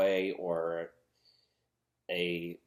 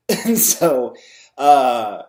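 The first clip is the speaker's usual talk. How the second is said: loud laugh; 6 semitones higher, 15 dB louder, much slower than usual. Real, not acted. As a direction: burst out laughing, much louder and higher. Bursts out laughing about prophet.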